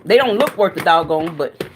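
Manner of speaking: ordinary delivery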